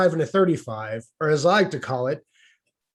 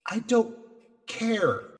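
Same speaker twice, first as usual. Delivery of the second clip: deadpan dismissal; 5 semitones higher, 7 dB quieter, much slower than usual. Retold or imitated. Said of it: "the best I could come up with was".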